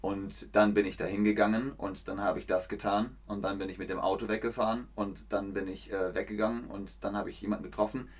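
quiet, fast, flat voice-over commentary; mock monotone voice-over droning over the original sound. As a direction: rattle it off like a dubbed documentary narrator. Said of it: "eher so ein Kommentar in Russisch".

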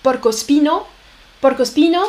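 "porcospino" said the Italian way, por-ko-SPEE-no; Italian pronunciation. Shown as a demonstration. Teacher giving the Italian source word porcospino. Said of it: "ça vient de l'italien".

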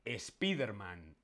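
"Spiderman" slowly as es-PEE-der-man; Spanish pronunciation. Shown as a demonstration. Teacher revealing the answer, Spanish pronunciation. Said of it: "la correcta es que lo pronunciamos".

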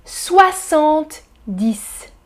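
soixante-dix said slowly, each syllable clear; slow clear model. Shown as a demonstration. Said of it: "on dit". (lentement et clairement : soixante-dix)

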